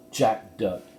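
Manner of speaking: plain reading voice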